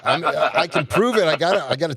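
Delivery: chuckling through the words; giggling defense; laughing. Real, not acted. Rider laughing while insisting he owns the bike.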